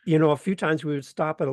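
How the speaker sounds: normal talking voice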